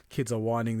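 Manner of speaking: ordinary talk, nothing marked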